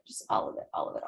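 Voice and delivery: whispered aside to yourself; sudden whisper. Real, not acted. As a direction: drop to a breathy whisper. Repeating phrase, fading to whisper.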